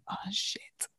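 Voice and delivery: soft panicked whisper; hushed mock panic; whispered oh shit. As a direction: breathe it out, caught out. Caught faking Geordie accent.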